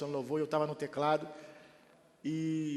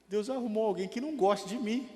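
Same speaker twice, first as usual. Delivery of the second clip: normal talking voice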